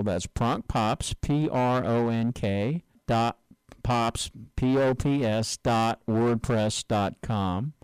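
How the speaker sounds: slow and deliberate, letter by letter; said slowly. Shown as a demonstration. Host spells out the show's web address for listeners, slowly. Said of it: "And let me say that slow".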